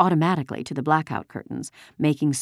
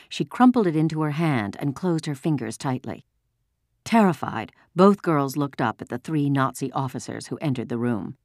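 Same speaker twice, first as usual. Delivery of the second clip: ordinary delivery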